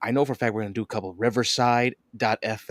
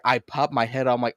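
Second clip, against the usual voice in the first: ordinary talk, nothing marked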